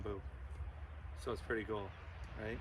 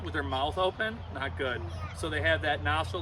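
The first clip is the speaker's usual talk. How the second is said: normal talking voice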